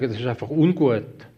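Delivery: a gentler, milder take on ungut, voice eased down; softened positive wording. Retold or imitated. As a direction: say ungut as the kind, softened version. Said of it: "Du musst es positiv sagen". (nachgemachte sanfte, beschönigende Stimme)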